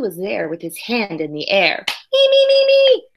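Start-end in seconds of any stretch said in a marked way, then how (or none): 2.1-3.0 put-on high, loud eager kid begging to be picked. Child in class begging the teacher to pick him, high eager squeal. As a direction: squeal it like a kid desperate to be chosen.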